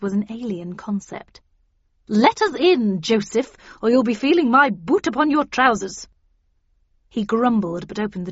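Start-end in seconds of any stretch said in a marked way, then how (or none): 2.1-6.1 loud, higher-pitched demand; impatient lady issuing a loud threat; imperious threat. A narrator's reading. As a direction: order the servant to open up. Havisham impatiently threatens the servant.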